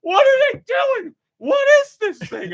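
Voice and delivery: very high, loud baffled yell; baffled outcry. Retold or imitated. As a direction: shriek it in total disbelief. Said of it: "You were like".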